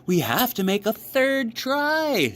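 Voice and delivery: excited frog full of hope; bright, chirpy storybook Frog; Frog's voice. A narrator's reading.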